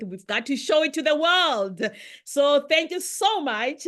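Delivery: usual speaking voice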